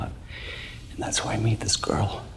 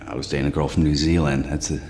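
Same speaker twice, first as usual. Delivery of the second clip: ordinary talk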